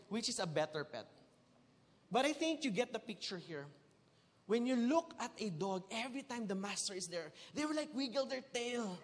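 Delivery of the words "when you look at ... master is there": ordinary talk, nothing marked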